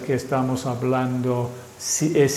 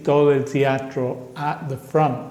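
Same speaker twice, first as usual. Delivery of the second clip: normal talking voice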